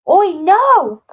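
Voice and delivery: very high, very loud scream. A narrator's reading. Jake screaming to stop Oi from leaping, very loud. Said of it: "he screamed".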